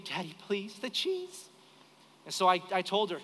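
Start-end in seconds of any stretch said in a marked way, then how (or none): 0.1-1.5 small soft beg climbing to a squeak; begging child. Retold or imitated. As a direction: plead like a little girl, softly. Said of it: "She begged me again".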